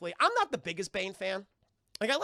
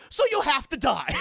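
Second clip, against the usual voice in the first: higher, much louder mock snap; villain threat; 7 semitones higher, 11 dB louder than usual. Woodrue condemning Pamela, loud hammed threat. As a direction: flip to menace. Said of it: "And he's like".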